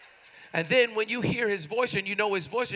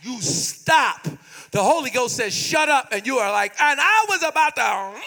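slow, emphatic preaching with stretched words; forceful preaching; 4 semitones higher than usual. Real, not acted. Preacher urging congregation to talk to God, forceful preaching. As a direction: drive each phrase home, slowly and forcefully.

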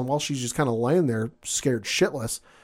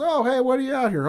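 friendly driver; 12 semitones higher than usual. Retold or imitated. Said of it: "The driver goes".

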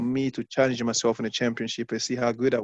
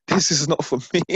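amused, laughing disbelief; laughing self-doubt; 3 semitones higher, 4 dB louder than usual. Retold or imitated. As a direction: say it through a laugh at yourself. Laughing at his own past doubt.

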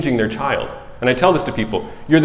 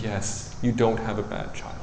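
gentle reassurance; 3 semitones lower, 11 dB quieter than usual. Retold or imitated. Psychologist reassuring crying parents.

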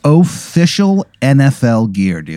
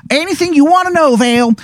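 put-on much higher, fast Baltimore accent; bad Maryland accent. Informant promises the player answers, thick Maryland accent. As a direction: rattle it off in a hammed-up Maryland accent. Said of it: "the worst Maryland accent".

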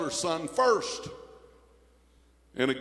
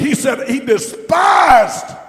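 high shouted preaching, drawn out on despised; 12 semitones higher, 13 dB louder, slower than usual. Real, not acted. Preacher thundering to the congregation, shouting. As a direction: belt it out from the pulpit.

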